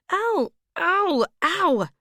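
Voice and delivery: high, loud yelps of pain, one after another; repeated cries of pain. Acted. Woman clutching her twisted ankle cries out in pain. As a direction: cry out over and over from the hurt ankle.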